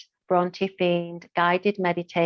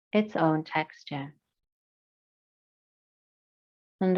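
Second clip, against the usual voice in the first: guided meditation. Acted. Yoga teacher leading listeners through breath and moorland, guided meditation. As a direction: guide them gently into stillness, phrase by unhurried phrase.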